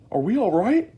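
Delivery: put-on high, loud worried old man; anxious cry. A narrator's reading. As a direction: cry out nervously. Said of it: "cried Grandpa Joe".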